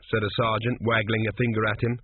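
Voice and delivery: ordinary delivery, nothing marked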